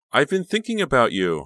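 high, loud and chirpy; cheery phone voice, pitched well up; cheerful. A narrator's reading. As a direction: gush cheerfully to a friend. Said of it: "Honey said cheerfully".

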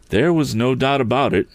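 ordinary delivery, nothing marked

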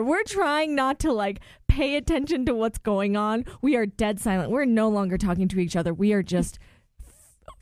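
ordinary talk, nothing marked

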